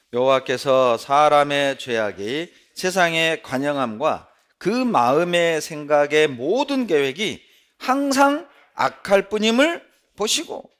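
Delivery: loud, ringing Bible reading to the hall; scripture reading. Acted. Preacher reading Genesis aloud to the congregation, loud and ringing. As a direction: proclaim the verse to the whole congregation.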